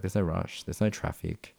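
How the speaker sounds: ordinary delivery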